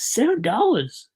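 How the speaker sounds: sudden high squeak in the voice; cracking upward in disbelief; voice went funny. Real, not acted. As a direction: let the voice jump up by accident. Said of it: "my voice just went funny".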